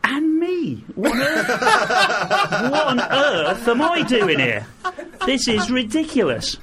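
high, laughing "what on earth" in amazement; laughing disbelief. Retold or imitated. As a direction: giggle through it, can't believe where you are. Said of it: "and I'm like".

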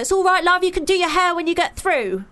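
mock hustling race official; imitating medal marshal. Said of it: "the guy giving me my medal was shimmying me along".

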